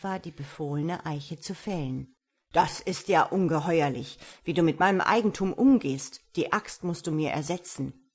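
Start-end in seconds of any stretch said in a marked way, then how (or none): 2.5-8.1 zorniger, lauter Ausbruch des Grafen; wütend schreiend. Acted. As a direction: schnell und laut losbrüllen. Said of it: "schrie der Graf". (der Graf brüllt den Holzfäller wütend an)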